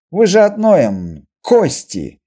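high, slow mock scolding; loud teasing. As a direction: draw out the joke loudly. Leader jokes to quarrelling pair. (громко, шутливо одёргивая)

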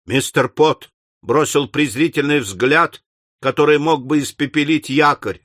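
ordinary delivery, nothing marked

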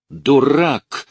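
loud, high outburst; beside-herself shout. A narrator's reading. Lucy yells at the orderly, furious shout. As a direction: shout the insult, losing control. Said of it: "крикнула на него, не помня себя".

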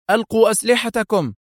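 high, urgent order; stern command. A narrator's reading. Woman agent ordering policemen to drop weapons, sternly. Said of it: "وهي تقول في صرامة".

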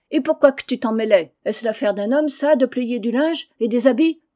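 loud shrill scolding of her husband; angry shouting. Acted. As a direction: yell back at him, fed up. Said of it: "criant à qui mieux mieux". (femme qui crie contre son mari)

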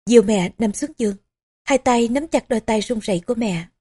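usual speaking voice

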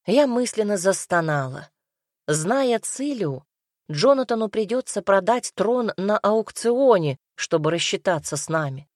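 plain reading voice

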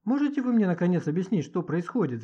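frustrated outcry with pitch leaping up; exasperated shouting. Acted. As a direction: burst out, demanding an explanation. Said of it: "закричала Маня".

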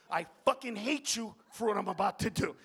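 ordinary talk

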